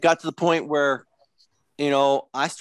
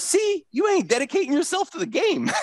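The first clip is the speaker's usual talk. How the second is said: much higher mock Jordan lecturing; Michael Jordan. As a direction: imitate Jordan scolding the late partier, pitched way up. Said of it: "and Jordan goes".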